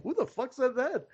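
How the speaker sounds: incredulous reaction. Retold or imitated. Retold baffled reaction to heckler.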